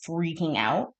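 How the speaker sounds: ordinary delivery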